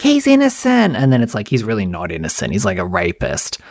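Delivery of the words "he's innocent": naive voice. Retold or imitated. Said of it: "I'm like".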